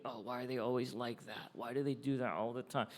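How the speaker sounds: soft, muttered complaint under the breath; grumbling mutter. Retold or imitated. Church member grumbling secretly about the leaders, softly muttered. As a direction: mutter it like a grumbler who doesn't want to be heard. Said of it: "It's like muttering under your breath".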